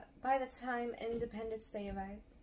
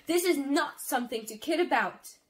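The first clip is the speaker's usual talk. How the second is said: loud, higher angry rebuke; rage; 4 semitones higher, 7 dB louder than usual. A narrator's reading. Little sister rebukes joking brother, in rage. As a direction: shock turning into an outraged scolding. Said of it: "my shock turned into rage".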